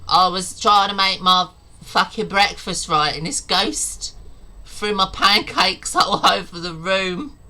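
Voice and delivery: hammed-up fury about ruined pancakes; mock outraged rant. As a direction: breaks into a laugh at the end of a mock rant. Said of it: "And in America, it's like".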